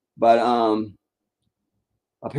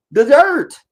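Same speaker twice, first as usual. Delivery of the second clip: shouted punchline; 13 semitones higher, 8 dB louder than usual. Real, not acted. Climax of rant on debates.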